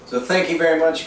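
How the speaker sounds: normal talking voice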